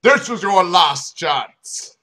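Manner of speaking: loud, slow shouted ultimatum; slow, loud police ultimatum. Acted. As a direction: bellow a final warning. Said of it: "he shouted".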